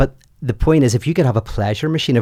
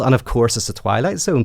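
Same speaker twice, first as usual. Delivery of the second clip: ordinary talk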